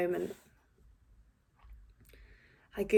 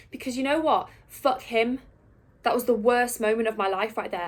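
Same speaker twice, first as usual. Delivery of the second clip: louder, higher, indignant defiance; angry outburst; 4 semitones higher, 5 dB louder than usual. Acted. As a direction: burst out in bitter self-justification. Angrily justifies punching the photographer.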